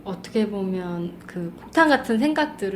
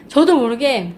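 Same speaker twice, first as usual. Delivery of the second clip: ordinary talk, nothing marked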